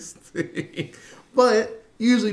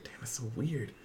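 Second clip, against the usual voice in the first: hushed aside; 4 semitones lower, 14 dB quieter than usual. Retold or imitated. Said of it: "I was like".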